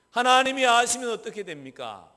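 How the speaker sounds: normal talking voice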